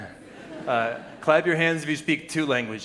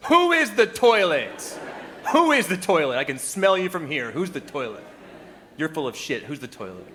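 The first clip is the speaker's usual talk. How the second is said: louder, higher hammed-up rant that fades toward the end; loud mock-indignant rant that trails off; 4 semitones higher, 4 dB louder than usual. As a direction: play the mistaken tourist accusing the toilet. Acted rant at restaurant.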